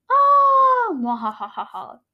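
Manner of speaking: evil laugh. Acted. Evil character Milky.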